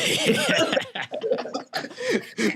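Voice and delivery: high-pitched burst of laughter; long, high laugh; real laugh. Real, not acted. Co-host cracks up at the retort, high laughter.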